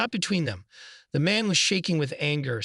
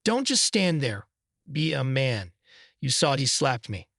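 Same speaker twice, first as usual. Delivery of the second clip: plain reading voice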